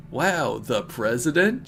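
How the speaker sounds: usual speaking voice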